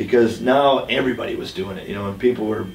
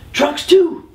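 put-on high, loud Southern accent call; 4 dB louder than usual. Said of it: "in his southern accent".

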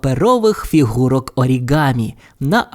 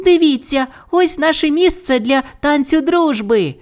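sudden excited cry, very high voice; high-pitched little character exclaiming; 13 semitones higher than usual. A narrator's reading. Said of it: "раптом скрикнула Бу".

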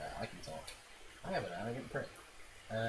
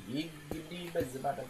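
random silly noises; 6 semitones higher than usual. Acted. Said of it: "I'm just making random noises".

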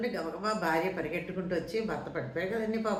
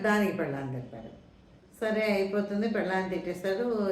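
plain reading voice

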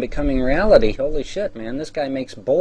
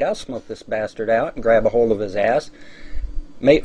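ordinary talk

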